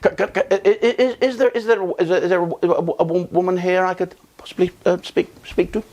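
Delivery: nervous customer asking the shopkeeper for a woman; sheepish stammer. A narrator's reading. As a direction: stammer it out like a bashful man too shy to ask. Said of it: "he's a bit sheepish".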